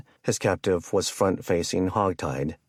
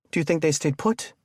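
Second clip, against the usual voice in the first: high, anxious call; fearful call; 10 semitones higher than usual. A narrator's reading. Taylor calls to Kenny fleeing the blockade, fearful. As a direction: call it out in alarm, voice raised high. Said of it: "loudly and fearfully enough to wake up Sierra".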